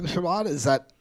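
ordinary delivery

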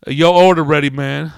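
loud, high call-out; counter guy. Acted. Counter guy telling customer the order's ready, loud call. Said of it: "the other guy behind the counter was all".

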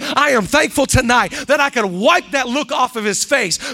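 loud, rising preacher's cadence; full-force preaching. Acted. Pastor preaching to the church, loud and building. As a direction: preach it out to the congregation.

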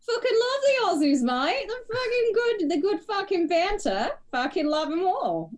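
very high, gushing mock-Aussie voice; excited, high-pitched put-on Australian accent. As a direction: gush like a hammed-up Aussie mate. Praises Aussies as an Aussie mate.

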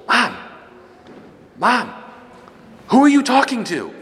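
put-on high, loud child calling mom; little girl voice. Toddler calling her mom in confession, high and loud. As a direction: call out like a curious toddler.

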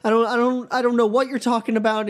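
very high, begging terrified hostage voice; pleading captive. Acted. Hostage Ezra begging the knife-wielding villain, shrill squeaky pleading. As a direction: squeal it out like a panicked prisoner.

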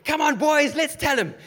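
high, loud rallying cry; rallying shout. Acted. Saint rallying the others to answer the angels, shouting. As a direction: rouse the crowd to shout. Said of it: "imagine them shouting".

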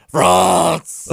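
sudden loud, high mock proclamation; pageant contestant impression. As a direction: blast the country name like a pageant contestant. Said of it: "the French one was like".

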